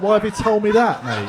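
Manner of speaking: high, loud incredulous laughing protest. Retold or imitated. Comic asks the doctor why he said that, incredulous. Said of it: "I was like".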